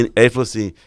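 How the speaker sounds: ordinary talk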